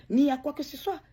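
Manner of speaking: usual speaking voice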